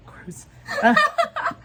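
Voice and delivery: breaking into real embarrassed laughter; cringing giggle that spikes high; laughing. Real, not acted. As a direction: laugh it off, squealing high at the peak. Embarrassed laugh at own words.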